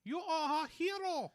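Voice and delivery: high-pitched, hammed-up German accent; high mock-German character voice; bad German accent. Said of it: "This is a bad German accent".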